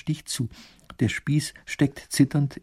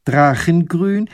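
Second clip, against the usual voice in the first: higher, slow, rising question; surprised echo; 5 semitones higher, 7 dB louder, slower than usual. A narrator's reading. Lady echoes the painter's colour in slow surprise. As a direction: echo it back in disbelief.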